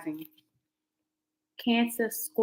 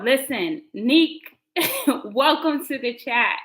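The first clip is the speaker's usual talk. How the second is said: normal talking voice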